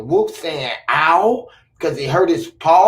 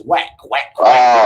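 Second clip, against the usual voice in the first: low whack quack; mock quack; 9 semitones lower, 6 dB louder, slower than usual. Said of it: "the duck says".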